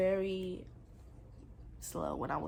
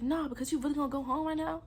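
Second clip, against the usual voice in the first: high, fast mock yell; retold yelling; 7 semitones higher, faster than usual. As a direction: imitate his heated demand, pitched up and rushed. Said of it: "stop yelling at me".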